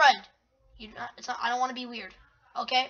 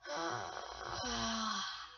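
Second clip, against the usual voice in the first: low, drawn-out sigh; long exasperated sigh; 4 semitones lower, 10 dB quieter than usual. Real, not acted. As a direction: let out a long, weary breath. Sighs at the game alert.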